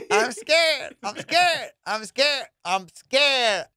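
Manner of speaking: high, exaggerated mimicry of a scared friend; mocking friend's voice. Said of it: "You're just good at doing voices".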